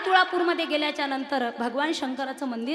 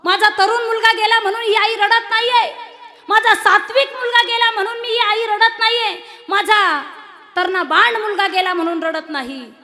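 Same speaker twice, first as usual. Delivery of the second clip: high, loud, impassioned cry of a mother; proud grieving mother; 9 semitones higher, 14 dB louder than usual. Retold or imitated. Preacher voicing the martyr's mother to the media, high, loud and impassioned. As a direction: cry out to God like a mother who would send more sons. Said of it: "मीडियावर बोलू लागली".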